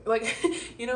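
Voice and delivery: ordinary delivery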